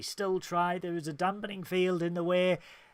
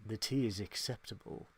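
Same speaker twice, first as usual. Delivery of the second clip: low, soft imitation of icy disdain; cold, stony verdict; 6 semitones lower, 11 dB quieter than usual. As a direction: grant approval coldly, in a hushed low voice. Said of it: "the fucking cold stone bitch that she is".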